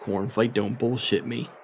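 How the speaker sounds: a hushed warning from the captain; low menacing warning. Acted. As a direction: lean in with a soft threat. Captain threatening player.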